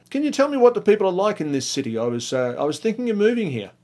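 eager stranger. Retold or imitated. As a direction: sound keen to move to a new town. Eager second stranger asks.

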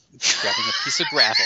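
high squeal of laughter; laughing. Real, not acted. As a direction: burst out laughing mid-sentence. Joke about mailing gravel.

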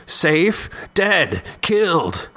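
high-pitched, agitated single words; voice rising. A narrator's reading. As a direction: let the voice climb in panicky disbelief. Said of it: "Jeremy's voice rose".

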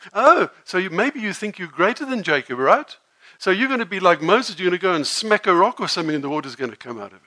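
loud mocking tirade, speeding up at the end; sarcastic shout. Woman scoffing that the teacher thinks he is Moses, loud and mocking. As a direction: ridicule him at full volume. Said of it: "in the most sarcastic tone".

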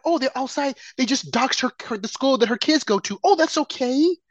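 high, airy mock unconcern; mocking parody of indifference; sarcastic mock voice. Mocking reaction to doxing.

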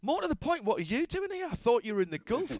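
high lightly voiced Johnny, amused; quoting Johnny. Retelling Johnny's surprised reply to the presenter at Le Mans, lightly voiced high.

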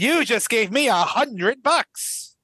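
slow, drawn-out politician impression; slow mock speechifying; Hillary Clinton. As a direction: stretch each phrase with swooping pitch. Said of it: "that was close to my Hillary Clinton".

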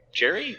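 Jerry asked with a rising tune; questioning name. Real, not acted. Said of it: "correcting the name Gary".

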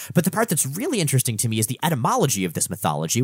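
ordinary talk, nothing marked

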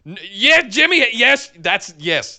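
excited rebuttal. Retold or imitated. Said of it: "And I was going".

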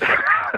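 quick high chuckle; real laugh. Real, not acted. Laughs after not quite.

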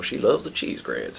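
lightly put-on Louisiana twang, leaning Texan; Louisiana accent. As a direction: imitate a man from north Louisiana. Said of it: "He talks like that".

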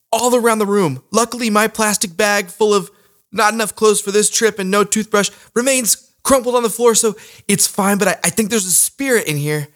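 high, shaky nervous voice; scared but pushing on. Acted. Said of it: "I'm nervous".

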